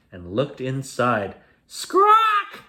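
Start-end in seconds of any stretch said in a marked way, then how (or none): 1.6-2.6 very high, loud parrot screech; parrot scream. Acted. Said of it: "screamed Parrot Polly".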